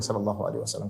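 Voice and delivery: soft, low listener's reply; hushed congregational response; quiet response. Shown as a demonstration. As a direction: murmur the blessing under your breath. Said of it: "tidak perlu kencang-kencang".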